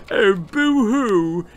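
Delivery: high, slow whiny boo-hoo; whining baby. A narrator's reading. Baby whining over his present, high sulky wail. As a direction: whine it like a crying toddler. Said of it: "he whined".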